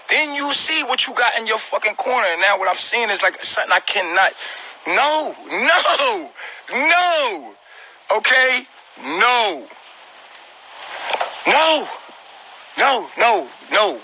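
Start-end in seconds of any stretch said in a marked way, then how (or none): 4.9-14.0 high, stretched, insistent no; each no pulled out high and long, pushing the thought away; drawn-out emphatic no. Real, not acted. Emotional vent about breakup.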